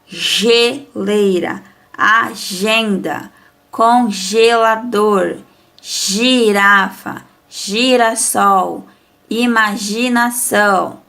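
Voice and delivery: devagar, sílaba por sílaba; leitura lenta e clara. Shown as a demonstration. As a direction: leia com calma, destacando cada palavra. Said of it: "Então, vamos lá". (professora lendo devagar para os alunos)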